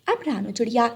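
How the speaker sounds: ordinary delivery, nothing marked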